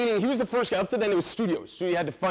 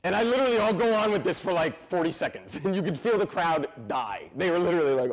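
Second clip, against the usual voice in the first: normal talking voice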